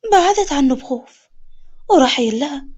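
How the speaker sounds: usual speaking voice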